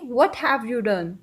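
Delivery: very slow, each word apart; slow English demo. Shown as a demonstration. Teacher slowly modelling the English question. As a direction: drill it word by word. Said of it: "इसको अब हम English में कैसे बोलेंगे".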